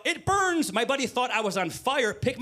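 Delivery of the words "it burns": high-pitched pained yelp; mock scream. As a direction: act out screaming in burning pain, voice way up. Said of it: "I screamed out".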